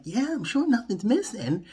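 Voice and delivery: high, sarcastic mock voice; mock sarcastic reply. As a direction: toss it off in a high, sarcastic put-on voice. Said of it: "And I'm like".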